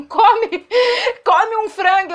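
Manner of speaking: tipsy retelling of the waiter; laughing mock waiter. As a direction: play the waiter as a drunk hears him. Waiter voice heard while drunk.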